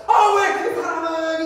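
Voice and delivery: loud, high, drawn-out burst of irritation; exasperated outburst. Acted. Person trying to pray remembers someone hated, exasperated. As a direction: blurt it out in sudden annoyance. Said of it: "뭐라고 하는지 알아요".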